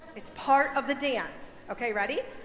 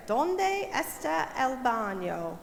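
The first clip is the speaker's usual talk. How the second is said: "¿Dónde está el baño?" spelled out slowly, word by word; slow it right down so the kids can follow; careful slow classroom Spanish; slow teaching pace; much slower than usual. Shown as a demonstration. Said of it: "let's put it together".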